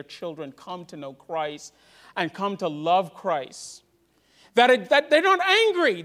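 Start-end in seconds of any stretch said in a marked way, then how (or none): 4.5-6.0 higher, louder impassioned preaching; preaching at full force. Acted. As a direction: lift it up to the congregation.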